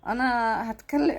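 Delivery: usual speaking voice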